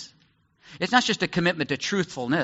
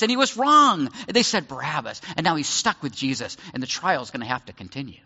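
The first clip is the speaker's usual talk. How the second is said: ordinary delivery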